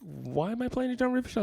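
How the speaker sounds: high, drawn-up baffled question; retold disbelief; incredulous protest. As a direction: act out bewildered objection, pitched well up. Retelling his reluctance to the label.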